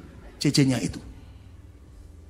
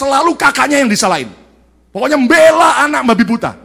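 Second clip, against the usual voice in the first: higher, louder forceful sermon; full preaching; 11 semitones higher, 11 dB louder than usual. Acted. Preacher hammering on parents who defend children blindly, loud. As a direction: drive the point home to the congregation.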